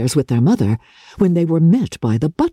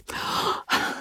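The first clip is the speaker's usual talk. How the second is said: gasp; 11 dB quieter than usual. Acted. Hearing letter news.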